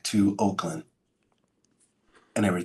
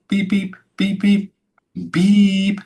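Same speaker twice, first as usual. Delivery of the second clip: high, louder mimicked monitor beeps; heart monitor beeps; 11 semitones higher, 6 dB louder, slower than usual. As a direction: imitate the beeping of a heart monitor.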